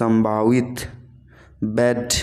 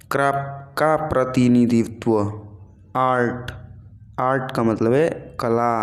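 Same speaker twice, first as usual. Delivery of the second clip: ordinary talk, nothing marked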